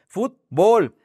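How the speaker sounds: "Fútbol" stressed on FÚT, the Mexican way. Shown as a demonstration. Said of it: "no lleva tilde".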